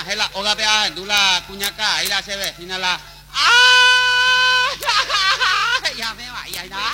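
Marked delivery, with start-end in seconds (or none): 2.8-4.8 high piercing cry of ay; sudden scream. Acted. Sudden scream mid story. 4.8-5.9 laughing cry. Acted. Scream turns into laughing cry.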